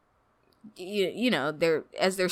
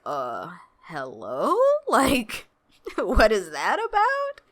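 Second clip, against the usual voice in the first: mock bewilderment; 2 semitones higher, slower than usual. Viewer reacting to a giant robot foot, mock bewildered.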